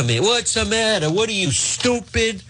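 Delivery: high mock cranky yell; hammed-up old boss scolding; berating Eva in mock fury; mock angry yell. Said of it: "yelling at Eva".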